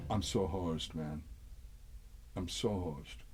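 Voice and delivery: low, quiet defeated mutter; resigned aside. Retold or imitated. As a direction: mutter it to yourself, doomed. His dejected reaction in the story.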